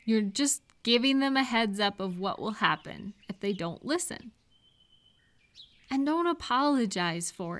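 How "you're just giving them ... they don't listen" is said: usual speaking voice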